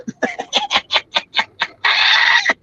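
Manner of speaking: breathless high-pitched wheeze of laughter; cracking up silently, breath squeaking; high wheezing laugh. Real, not acted. Comedian wheezing at his own story, high laugh.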